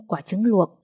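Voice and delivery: usual speaking voice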